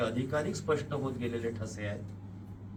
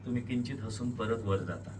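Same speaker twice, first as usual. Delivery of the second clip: ordinary delivery, nothing marked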